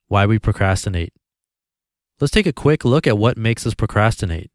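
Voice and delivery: ordinary talk, nothing marked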